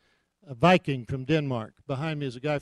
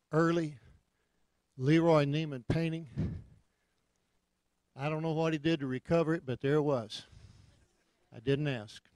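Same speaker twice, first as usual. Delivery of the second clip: ordinary talk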